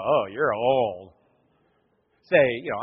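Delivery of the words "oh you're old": low, slow letdown; unimpressed reaction. Retold or imitated. People hearing he is forty-five, low slow unimpressed verdict. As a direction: sink down low and draw it out. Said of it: "and they go".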